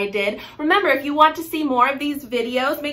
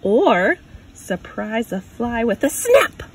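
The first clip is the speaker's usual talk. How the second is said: put-on reading to a child. Parent reading a picture book aloud, gentle storytelling. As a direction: read it like a picture book for a little one.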